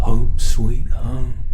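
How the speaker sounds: loud, slow proclamation; grand arrival. Acted. Monster announcing its homeland to the captive, loud and slow. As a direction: boom it out slowly like a triumphant homecoming.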